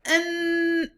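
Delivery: high squeaky mouse refusal; Little Mouse voice. Acted. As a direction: brush off the request, high. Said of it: "said Little Mouse".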